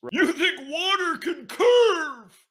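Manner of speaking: high, slow sneering question; mocking flat earther. Ranting host mocks flat earthers, high and slow. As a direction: drag it out high like a mocking dupe.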